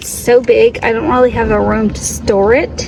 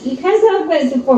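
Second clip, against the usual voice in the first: ordinary talk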